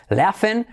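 "laughing" as "LEA-fen", GH as F; correct LEAF-en sound. Shown as a demonstration. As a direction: stretch "laughing" into "leafen", with E in the ending. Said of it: "se pronuncia como una F". ("laughing" pronunciado "léafen")

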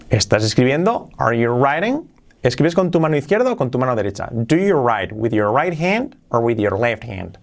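usual speaking voice

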